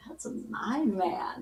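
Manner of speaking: proud whisper. Retold or imitated. Proud wife about flirting.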